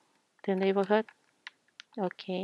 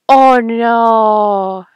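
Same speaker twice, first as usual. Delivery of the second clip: shocked oh no; 2 semitones higher, 12 dB louder than usual. Real, not acted. Seeing her daughter's old villager arrive, shocked shout. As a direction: blurt it out in real dismay.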